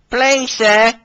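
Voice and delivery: high, eager little tank engine; high, polite, eager plea; polite Billy. Acted. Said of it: "asked Billy politely".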